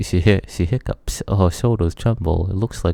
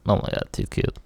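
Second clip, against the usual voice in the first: deep gush; 12 semitones lower, 6 dB quieter, faster than usual. Real, not acted.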